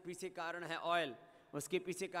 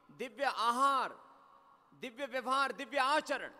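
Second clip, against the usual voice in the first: each Divya phrase higher and louder, climbing; building litany; 4 semitones higher, 5 dB louder than usual. Acted. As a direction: chant the list, rising higher and louder. (हर "दिव्य" के साथ ऊँचा और ज़ोरदार होता जाप)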